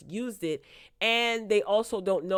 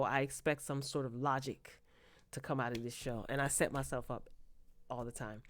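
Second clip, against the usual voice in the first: resigned trailing off; 6 semitones lower, 11 dB quieter than usual. Real, not acted. Admitting she always falls for it, quiet and resigned. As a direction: lower and much softer, fading out. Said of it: "I do this all the time".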